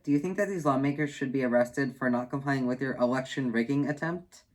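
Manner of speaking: ordinary talk, nothing marked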